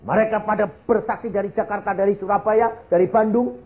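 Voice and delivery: high, insistent testimony to a congregation; fervent preaching. Real, not acted. Preacher telling saudara of many witnesses, in high, fervent proclamation. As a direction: ring it out like a preacher.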